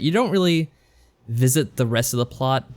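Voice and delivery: normal talking voice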